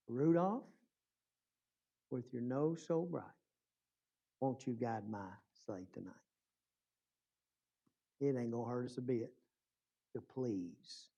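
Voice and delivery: low, very soft, solemn appeal; hushed preaching. Real, not acted. Preacher urging congregation to please the master, hushed and solemn. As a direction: bring it down almost to a whisper, with long pauses.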